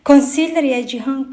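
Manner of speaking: ordinary delivery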